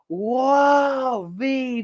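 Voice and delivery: ordinary delivery, nothing marked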